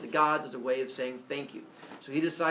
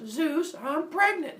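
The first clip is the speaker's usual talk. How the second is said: high nymph announcing news; high and bright, calling Zeus; 13 semitones higher, 3 dB louder, slower than usual. Acted.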